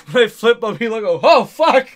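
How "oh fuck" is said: high, loud, startled yell with a laugh; startled shout. Retold or imitated. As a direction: yell it like you got the scare of your life. Opponent startled by flip bump.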